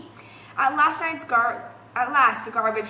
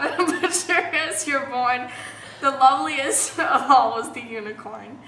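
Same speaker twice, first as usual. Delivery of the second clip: laughing through words; 5 dB louder than usual. Real, not acted. Reader recovering from a slip, laughing as she reads. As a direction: push the line out through giggles. Reading through her giggles.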